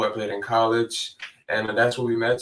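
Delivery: normal talking voice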